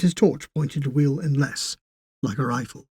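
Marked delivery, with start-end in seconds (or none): none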